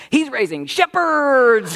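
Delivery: put-on high, loud, slow and drawn out like a small child; a proud five-year-old chiming in on cue; proud little girl. Little Hazel finishing her father's saying, high, loud and proud. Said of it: "my little five-year-old Hazel goes".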